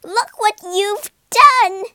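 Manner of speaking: loud, high and slow accusing shout; furious scolding. A narrator's reading. Wife blaming Egbert for her lump, loud and slow. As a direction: blame him at full volume.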